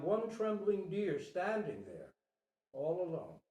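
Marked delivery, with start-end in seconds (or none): none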